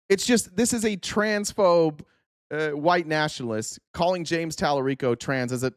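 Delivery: ordinary talk, nothing marked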